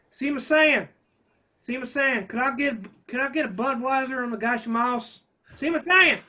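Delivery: loud Texan twang calling out a Japanese name; Texas drawl. Retold or imitated. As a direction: call to the bartender like a Texas sailor. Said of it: "he talked like this".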